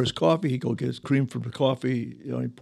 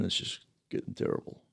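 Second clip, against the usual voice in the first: hushed sadness; 11 dB quieter than usual. Real, not acted. Wife's stroke, voice drops.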